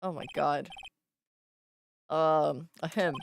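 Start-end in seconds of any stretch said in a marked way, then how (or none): none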